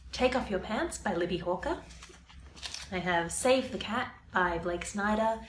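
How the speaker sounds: usual speaking voice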